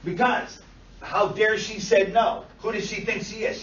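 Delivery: mock-angry ranting, hard emphasis; indignant client. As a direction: demand it like an offended, rejected suitor. Voicing the client's angry thoughts.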